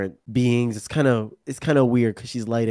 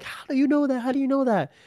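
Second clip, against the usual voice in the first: mock astonishment in a much higher voice; incredulous questioning; faster than usual. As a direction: imitate someone baffled, pitched way up. Others' astonished reaction, voiced.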